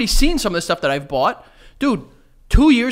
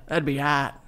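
Australian attempt that comes out Boston; botched Australian accent, slightly lower; failed Australian accent; 3 semitones lower, 4 dB quieter, slower than usual. Shown as a demonstration. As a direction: try Australian and land in Boston. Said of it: "That was Boston".

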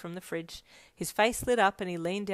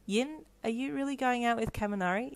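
higher, slightly hurt question; tinge of hurt; 6 semitones higher than usual. A narrator's reading. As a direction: ask with understated hurt. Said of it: "a tinge of hurt in his voice".

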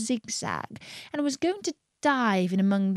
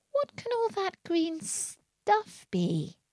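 high, soft, slow wondering; small high voice, slowly puzzled; wondering alarm; 10 semitones higher, 5 dB quieter, slower than usual. A narrator's reading. Alice looking down at the leaves, softly alarmed.

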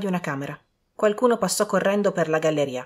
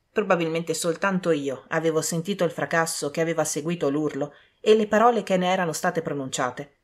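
ordinary delivery, nothing marked

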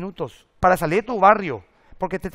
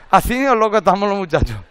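joking voice; 6 dB louder than usual. Acted. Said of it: "Estoy haciendo un chiste".

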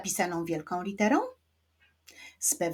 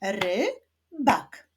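"Rybak" as RY... BAK, two separate beats; "Rybak" split, rising ry then falling bak; syllable by syllable. Shown as a demonstration. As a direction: clap out "Rybak" with a pause after ry. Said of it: "Wyklaszczmy najpierw sylaby w wyrazie rybak".

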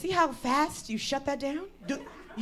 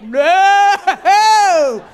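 very loud, high, long wail; hammed-up desperate holler; panicked yell; 6 semitones higher, 14 dB louder than usual. Acting out crossing the line near home, panicked yell.

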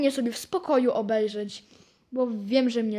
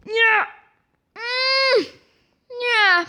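high loud despairing no; dismayed cry; 10 semitones higher, 4 dB louder, much slower than usual. Real, not acted. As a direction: cry out at the loss. Reacting to losing the match.

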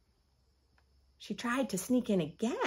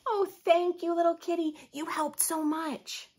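higher, louder thanks, slowing at the end; grateful little girl; 7 semitones higher, 3 dB louder than usual. Acted. Girl thanking the cat for helping her read, higher child voice. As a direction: thank the kitty sweetly.

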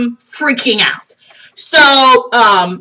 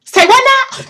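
high, loud double take; exaggerated stunned question; mock disbelief; 13 semitones higher, 4 dB louder than usual. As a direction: squawk it in astonishment. Stunned reaction to designer's name.

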